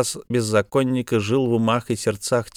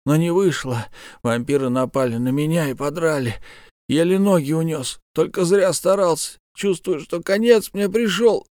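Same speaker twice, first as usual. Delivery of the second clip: pained, strained bandit voice; wounded bandit; 6 semitones higher than usual. Acted. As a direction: speak through fresh wounds. Dying bandit speaks.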